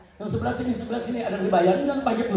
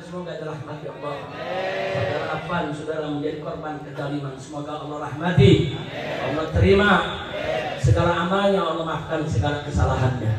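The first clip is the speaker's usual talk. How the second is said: low-pitched spoken prayer; low, earnest supplication; solemn prayer; 5 semitones lower than usual. Real, not acted. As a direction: lead the crowd in praying for the dead. Prays for the dead.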